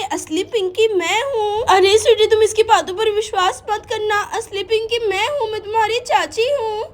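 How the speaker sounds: usual speaking voice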